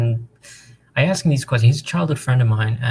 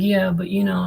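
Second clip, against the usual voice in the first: stumped; 8 semitones higher than usual. Retold or imitated. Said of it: "and he gets stumped".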